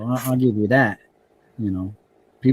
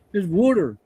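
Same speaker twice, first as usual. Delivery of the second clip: water in a Pennsylvania accent, pitched up; mock the Pennsylvania water; a hint of a Pennsylvania accent; 7 semitones higher than usual. Shown as a demonstration. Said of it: "'cause you call it water".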